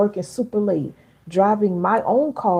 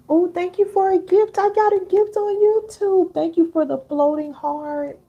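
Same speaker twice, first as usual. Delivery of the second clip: high, loud, gushing thank-you; delighted, much higher and louder gratitude; excited thanks; 12 semitones higher, 5 dB louder than usual. Real, not acted. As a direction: squeal your thanks for the gift. Thanking viewer for live gift.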